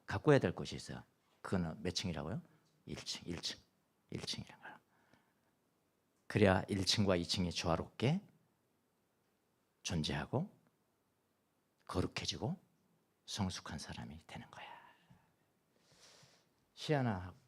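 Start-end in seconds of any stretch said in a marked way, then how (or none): 2.8-4.8 unvoiced, hushed repetition; whispered emphasis. To the congregation, a whispered key point. 13.2-14.7 unvoiced, hushed final line; whispered conclusion. Closing the lesson to the congregation, whispered.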